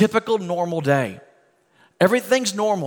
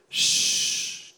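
long soft shushing sound; hushing shh; 8 dB quieter than usual. Shown as a demonstration. Preacher showing the Greek word for silence, hushing. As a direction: hush the storm with a drawn-out shh. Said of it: "this is the word it is".

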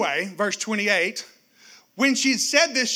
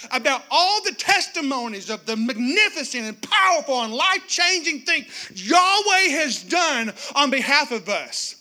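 preaching at full force; 5 semitones higher, 4 dB louder than usual. Real, not acted. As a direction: lift the room with fervor.

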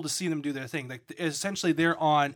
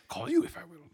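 Yoda voice; 14 semitones higher than usual. Retold or imitated.

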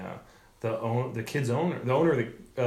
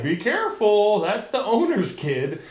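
higher, drawn-out cautioning voice; mock warning; 8 semitones higher, 4 dB louder than usual. Someone warns the comic about the owner's kid, higher mock caution. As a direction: imitate a bystander's singsong warning.

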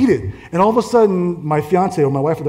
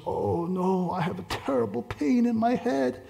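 soft, high, pained imitation; ailing woman; 6 semitones higher, 9 dB quieter, slower than usual. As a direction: moan it weakly as the suffering patient. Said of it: "And she goes".

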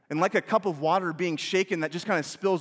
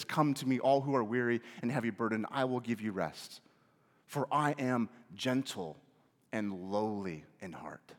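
gentle invitation; 6 semitones lower, 6 dB quieter than usual. Real, not acted. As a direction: invite the weary softly. Quoting Jesus's gentle invitation.

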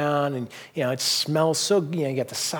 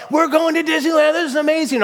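put-on thrilled child; 13 semitones higher, 6 dB louder than usual. His youngest daughter cheering over the Disneyland trip, high loud delight. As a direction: shout it like a kid who just got the best gift.

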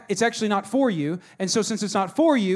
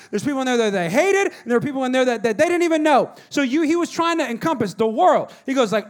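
ordinary talk

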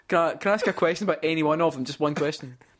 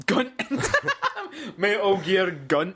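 laughing; 5 semitones higher, slower than usual. Real, not acted. Laughing while repeating the name.

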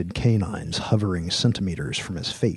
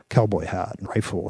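plain reading voice

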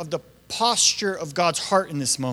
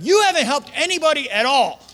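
frustrated; 7 semitones higher, 3 dB louder than usual. Retold or imitated. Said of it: "He's like".

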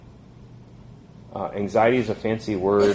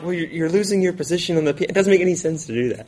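higher-pitched exasperated reasoning; retold parental protest, pitched up; mild exasperation; 8 semitones higher, faster than usual. Father reasoning with his annoyed daughter, mildly exasperated.

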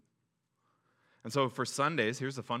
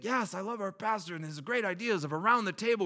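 gushing churchgoer; 7 semitones higher than usual. Retold or imitated. Congregant praising pastor.

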